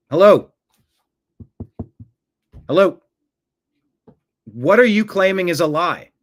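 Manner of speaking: loud, sharp demand for a reply; impatient calling out. Real, not acted. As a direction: call out loudly to someone not answering. Calling out to unresponsive commenter.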